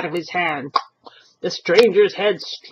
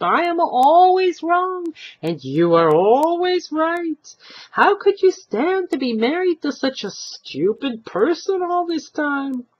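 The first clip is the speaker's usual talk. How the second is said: sarcastic; 11 semitones higher, 4 dB louder than usual. Acted. Old man sarcastic.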